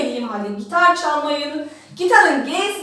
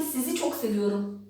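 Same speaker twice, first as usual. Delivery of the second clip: softly; 6 semitones lower, 9 dB quieter than usual. Shown as a demonstration. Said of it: "O zaman de ki".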